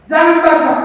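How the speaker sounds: loud, high "Jangan baca" as a stern order; mock forbidding. The paper forbids anyone to read it, loud order. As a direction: bark it like a sign forbidding reading. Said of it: "Kertas tempat tulisan itu nggak boleh bilang".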